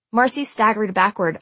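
plain reading voice

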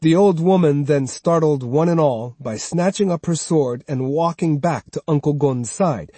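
ordinary delivery

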